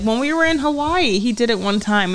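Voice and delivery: normal talking voice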